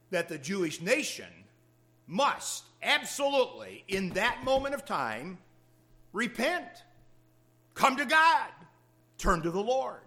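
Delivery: high, loud urgent pulpit cry; preaching at full force. Acted. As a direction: plead from the pulpit, climbing. Preacher pressing the call to repent.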